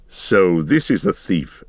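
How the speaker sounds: slow, weighty wizard voice; grave wizard. A narrator's reading. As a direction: say it slowly, like a grave old wizard. Gandalf's line in story.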